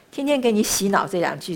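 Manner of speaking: ordinary delivery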